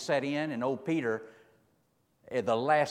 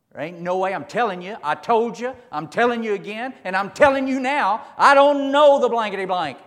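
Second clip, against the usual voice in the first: rising to a furious shout, much higher and louder; a panicked man shouting his denial louder and louder; emphatic shouting denial; 10 semitones higher, 12 dB louder than usual. Acted. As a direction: Peter swearing he never knew him, building to a yell.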